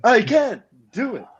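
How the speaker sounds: high, slow delivery with big dramatic pauses; Shatner. Acted. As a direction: ham it up, pausing hugely between words. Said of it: "that Shatner-esque big pauses in his lines".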